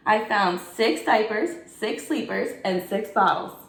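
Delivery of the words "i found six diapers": ordinary delivery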